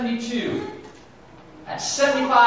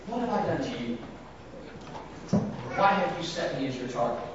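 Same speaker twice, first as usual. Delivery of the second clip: softer, lower, hushed plea; quiet lament; 6 semitones lower, 9 dB quieter than usual. Acted. Job's question repeated softly to God. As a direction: let the question fall away sadly.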